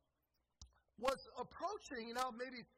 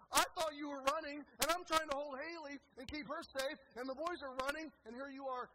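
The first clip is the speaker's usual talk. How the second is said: raised, higher-pitched protesting rant; acted-out flustered husband; exasperated; 5 semitones higher, 7 dB louder than usual. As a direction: complain loudly to your wife, indignant. Exasperated husband rants at wife.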